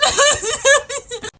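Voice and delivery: put-on high, loud kid bawling; hammed-up hysterical sob; mock sobbing. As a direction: keep wailing like an inconsolable small child. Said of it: "I start sobbing".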